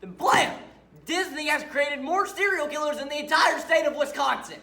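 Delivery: very loud, high-pitched climax of a rant; full-force deranged yelling; furious shout. Acted. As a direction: explode into a wild accusation.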